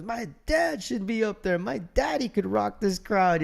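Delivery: high-pitched, gushing imitation of a daughter; acted-out girlish pride; proud daughter. Proud daughter cheering for her dad.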